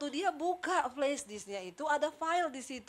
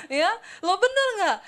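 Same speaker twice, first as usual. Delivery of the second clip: ordinary talk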